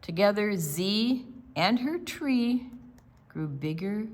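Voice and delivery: lively storybook read-aloud; picture book read to a group of children. Acted. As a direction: read it like storytime for little kids.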